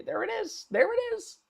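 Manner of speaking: very high, soft singsong; singsong discovery. Real, not acted. Host finds what he was looking for, high singsong. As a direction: sing it out lightly in a high little voice. Finds the item being looked for.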